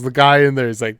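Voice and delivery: ordinary delivery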